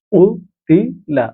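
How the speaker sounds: উতিলা dragged out syllable by syllable; very slow drill. Shown as a demonstration. Teacher slowly sounding out উতিলা for Quran reading students. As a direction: stretch উতিলা out so learners catch each sound. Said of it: "teacher drilling the word".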